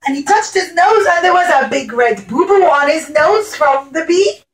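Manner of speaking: plain reading voice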